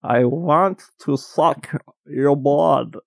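put-on slow, drawn-out Transylvanian accent Dracula line; a light Transylvanian accent, stretched slow. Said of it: "That was a dynamite Transylvanian accent".

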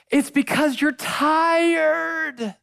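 "tired" held very long on a high pitch; stress on tired. Real, not acted. Preacher consoling the struggling, "tired" drawn out long and high. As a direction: stretch out "tired" high and slowly. Preacher drives home his main point.

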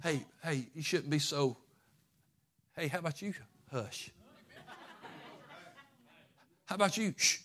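quietly telling him to hush. Retold or imitated. Crowd hushing, mocking.

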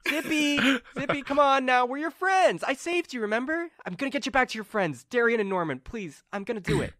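high-pitched, urgent coaxing; pleading friend. Acted. Carmen begs Zippy to trust her friends, pleading.

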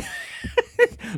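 very high, soft squeal; mock scream. Showing how Banshee flies, high mock scream. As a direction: squeal like a silly cartoon screech. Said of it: "But then he screams".

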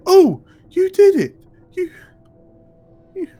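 very high, loud delighted shout; thrilled, shouting before he faints; Davin's excited cry. Acted. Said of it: "Davin looks up from behind the horses and goes".

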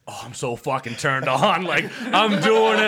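put-on slow, drawn-out drunk talk; imitated drunk. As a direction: act out your drunken self, dragging the words. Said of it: "I'm drunk. I'm like this".

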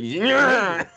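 high, loud mock yell of triumph; mock shout. Teleporting wrestler shouting, a high mock yell. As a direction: belt out a silly high yeah. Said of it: "He's like".

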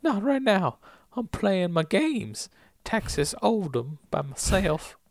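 high, slow Southern-tinged goofy voice; busy hermit at play. Acted. Recapper as Oldham playing games by himself, goofy and reluctant. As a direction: whine it slowly, wanting to be left alone. Acting Oldham answering from inside.

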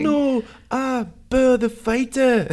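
mock Liverpool narrator voice; Scouse accent. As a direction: ham up a thick Scouse accent. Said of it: "the strongest Liverpool accent".

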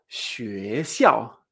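"xuexiao" rising, then sharply falling; teacherly "xuexiao", up then down; tones two-four. Shown as a demonstration. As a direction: climb on "xue", drop on "xiao". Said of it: "del dos cuatro".

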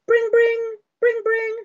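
high sing-song bring bring; phone ring imitation.

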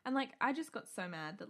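ordinary talk

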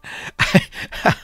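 short breathy chuckle; real chuckle. Real, not acted. Preacher admitting he too holds grudges, amused chuckle. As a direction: laugh lightly at your own confession. Laughs at own mock plea.

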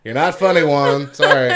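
laughing. Real, not acted. Teasing, laughing loudly.

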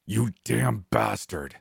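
a friend cursing his friend's killer, slow angry growl. A narrator's reading. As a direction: spit the words out slowly, choked with fury. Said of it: "Brian growled".

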